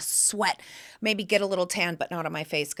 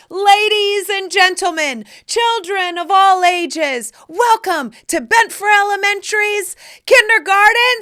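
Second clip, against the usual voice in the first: loud, high circus ringmaster call; ringmaster announcing; 10 semitones higher, 8 dB louder than usual. Retold or imitated. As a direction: boom it to the big top. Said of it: "I walked up and I said".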